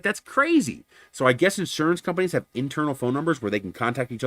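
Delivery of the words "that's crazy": high, incredulous exclamation; retold astonishment. Agent hearing about the ignored calls, astonished. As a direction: mimic someone appalled on your behalf. Retold shocked reaction to the news.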